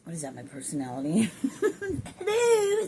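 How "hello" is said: very high, drawn-out loud greeting; playful high hello. Pretend. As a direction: call it out in a silly high voice. Playful voicing of what it says.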